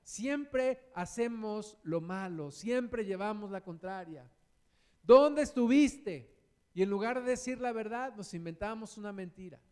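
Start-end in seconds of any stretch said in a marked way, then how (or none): 5.0-6.3 slow, loud, accusing call; loud demanding question. Acted. Confronting someone who lies, a slow loud demand.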